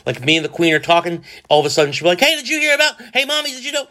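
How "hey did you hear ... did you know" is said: put-on high, eager kid voice bursting in; imitating little daughter. Dad voicing his daughter interrupting, high and eager. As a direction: play the little girl butting in.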